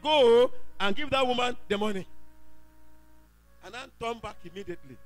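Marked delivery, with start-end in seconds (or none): 0.0-2.1 louder, pressing divine command; insistent voice from God. Retold or imitated. God's voice repeats the command, louder and insistent. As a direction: repeat the order with more force. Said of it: "He said".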